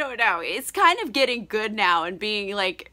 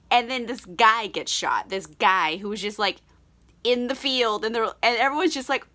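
ordinary delivery

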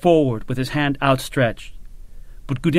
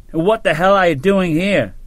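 raised, belligerent challenge; truculent demand; 5 semitones higher, 3 dB louder than usual. A narrator's reading. Soldier confronting his old colonel, truculent. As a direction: throw the question at him like a fist. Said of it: "Sharp demanded truculently".

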